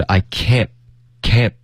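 "can't" cut short with the T unreleased; "can't" ending in an abrupt silent stop; dropped T. Shown as a demonstration. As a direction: stop "can't" dead before the T. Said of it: "can't的特会省略".

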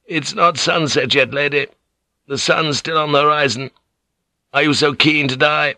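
high, pointed challenge; taunting general. A narrator's reading. General taunts lady about to die, haughty challenge. Ishido's voice in narration.

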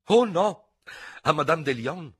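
high, loud, indignant correction; piqued little outcry; peeved protest. A narrator's reading. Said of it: "protestò con una risatina stizzita".

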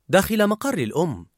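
ordinary delivery, nothing marked

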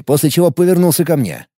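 ordinary delivery, nothing marked

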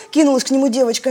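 usual speaking voice

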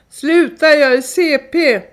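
high, loud cry to stop; retold scream. A narrator's reading. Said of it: "så skriker jag". (återberättat nödrop, högt skrik)

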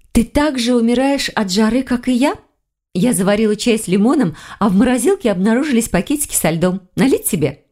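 a hint of a cheerful young woman offering tea; Lilya's voice. A narrator's reading. Narrator voices Lilya's dialogue.

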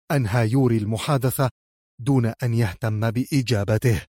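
ordinary delivery

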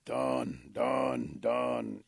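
loud, punched-out single words; blunt bullfrog report. Acted. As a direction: croak it out three times, curt and forceful. Bullfrog reports back to eagle.

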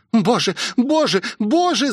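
put-on high-pitched frightened girl; high, alarmed pleading; panicked cry. A narrator's reading. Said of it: "закричала Роузи".

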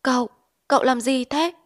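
panicked. Acted. Wife holding back her raging husband, panicked. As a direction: grab his arm and plead in panic. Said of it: "Vợ anh cuống queo níu lại".

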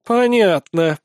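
plain reading voice

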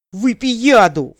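high, loud, drawn-out howl. A narrator's reading. Suspect to his interrogator, howling in exasperation. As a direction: wail the curse slowly at full voice. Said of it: "взвыл Элмышер".

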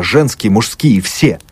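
usual speaking voice

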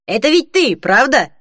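high, excited question; eager. A narrator's reading. Young clerk to the woman from TV, eager. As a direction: press eagerly for confirmation. Mark eagerly confirming he recognised Holly.

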